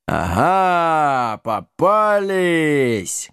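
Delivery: drawn-out giant's gotcha; hoarse muffled giant. A narrator's reading. Giant catches the company, hoarse and drawling. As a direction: stretch it out like a hulking giant. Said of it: "Голос великана был хриплый и глухой".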